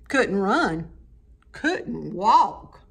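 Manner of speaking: plain reading voice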